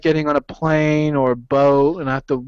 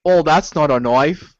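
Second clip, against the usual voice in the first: a hint of Aussie Dundee bravado; Crocodile Dundee impression. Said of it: "Crocodile Dundee".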